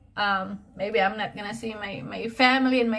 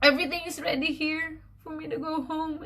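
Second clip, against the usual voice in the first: tearful; 5 semitones higher, 5 dB quieter than usual. Real, not acted. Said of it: "I'm just being emotional".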